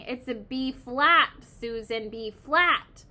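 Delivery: slow, loud yell hammering the note; exasperated yelling. A narrator's reading. Music teacher correcting Susan's wrong note, exasperated yelling. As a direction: yell the correction at a hopeless pupil. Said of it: "he kept yelling".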